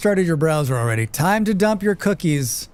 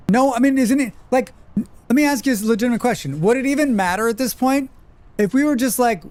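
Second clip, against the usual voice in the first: worked up; 7 semitones higher than usual. Real, not acted. Arguing about the war.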